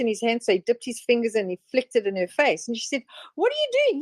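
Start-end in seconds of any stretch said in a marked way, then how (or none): 3.3-4.0 much higher mock woman's voice; mimicked woman. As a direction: squeak it up as the troubled wife. Woman reacting to water flick.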